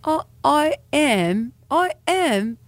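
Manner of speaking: high, drawn-out attempt at British; British accent. Shown as a demonstration. Trying out affirmations for the co-host, British accent. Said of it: "in a British accent".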